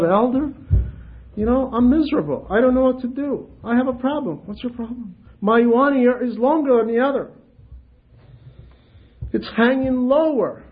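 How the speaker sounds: high, plaintive whine; miserable complainer. Retold or imitated. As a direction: sound put-upon and worried.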